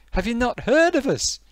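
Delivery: high-pitched Geordie disbelief; indignant Geordie. Acted. Geordie to someone ignorant of his nation, indignant. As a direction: demand it, voice jumping up.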